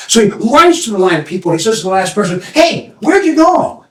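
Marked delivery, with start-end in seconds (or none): 2.4-3.8 high, eager call; young man calling. Acted. Young man calling to the last person in line, high and eager. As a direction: call out to the stranger.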